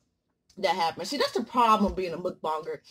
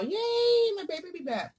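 excited cheer; 8 semitones higher than usual. Real, not acted. Excited about baby coming back.

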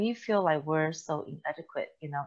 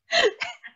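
high burst of laughter; 5 dB louder than usual. Real, not acted. Laughing at the memory.

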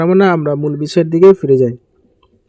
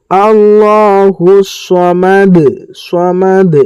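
slow melodic tajweed chant; measured Quranic recitation; much slower than usual. Shown as a demonstration. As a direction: recite slowly, drawing out each vowel.

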